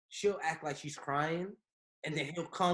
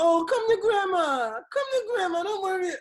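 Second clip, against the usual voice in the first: doting grandma; 8 dB louder than usual. Retold or imitated. Son mimicking his mom spoiling his child, high cooing. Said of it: "She be like".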